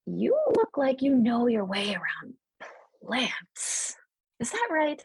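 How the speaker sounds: high, brisk questioning elder elf voice; eager elder. Acted. Elder elf asking the young druid about plants, high eager voice. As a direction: snap to attention and ask keenly. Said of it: "she would say".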